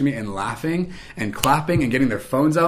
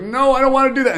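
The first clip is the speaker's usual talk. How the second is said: high, loud mock whine of refusal; outward protest; 14 semitones higher, 6 dB louder than usual. Employee outwardly refusing her boss, loud protest. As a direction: push back in a raised, protesting voice. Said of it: "even though outwardly I was like".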